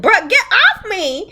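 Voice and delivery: high, drawn-out protest; mock outrage. Friend acting the heroine fending off a groper, mock outrage. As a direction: shove someone away with the voice, hammed-up.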